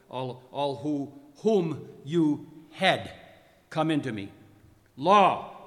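building from measured command to a shouted "Come!"; grand, swelling theatrical command; commanding summons. A narrator's reading. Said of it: "I commanded those who were present".

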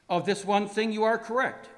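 high, loud dramatic proclamation; booming declaration. Acted. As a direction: declaim it loudly to a foe. Acted reading of Death's reply.

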